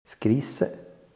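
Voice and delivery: ordinary delivery, nothing marked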